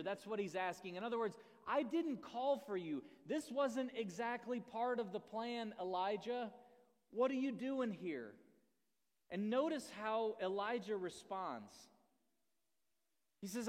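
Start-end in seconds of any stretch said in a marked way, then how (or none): 1.7-8.3 stern God. Acted.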